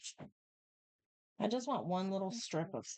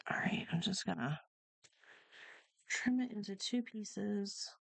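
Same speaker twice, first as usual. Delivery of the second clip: whispering; 3 semitones higher than usual. Real, not acted. Whispered aside.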